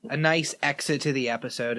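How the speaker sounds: ordinary talk, nothing marked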